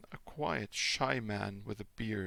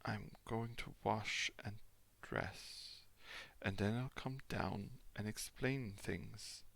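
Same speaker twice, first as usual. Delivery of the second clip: slow, soft halting speech; exhausted traveller, slow and faltering; 5 dB quieter, slower than usual. Acted. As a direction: grope for each word, worn out. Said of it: "feeling his way among his words".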